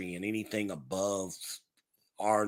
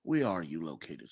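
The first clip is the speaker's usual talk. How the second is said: normal talking voice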